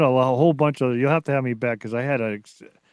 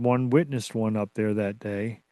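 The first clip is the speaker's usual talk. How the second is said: ordinary talk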